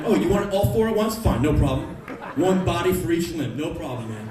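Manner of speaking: loud cocky hammed-up swagger; mock tough-guy boast. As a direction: brag like an action hero. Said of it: "what am I gonna go".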